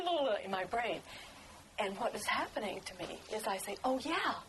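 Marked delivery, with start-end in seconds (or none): 3.8-4.3 rising higher and higher with delight; elated, half-laughing epiphany; excited realization. Retold or imitated. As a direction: let the discovery lift your voice. Sudden realization at the Capitol.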